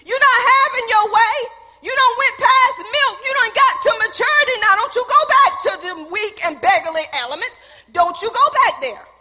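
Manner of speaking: high, loud rebuke shouted at yourself; commanding self. Retold or imitated. Preacher shouts at the self to take control. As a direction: order Self out of your house with fire. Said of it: "take authority over self and say".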